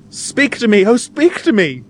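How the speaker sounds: very high, melodramatic plea; theatrical heroine's wail; imploring cry. A narrator's reading. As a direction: beg him desperately, high-pitched. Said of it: "cries Tom's young lady".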